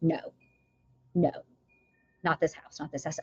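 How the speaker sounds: hushed, firm inner no; soft insistent refusal, fading away; God's quiet no as she retells it. Retold or imitated. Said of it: "this overwhelming".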